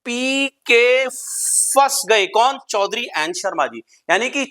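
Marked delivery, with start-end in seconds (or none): 0.0-2.3 drawn out slowly; slow stress. Shown as a demonstration. Teacher stretching the phrase slowly for students. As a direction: stretch each word out slowly. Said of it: "कैसे फंसे?".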